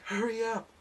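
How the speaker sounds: put-on high pushy girly demand; girlfriend voice. Girlfriend impatient on phone.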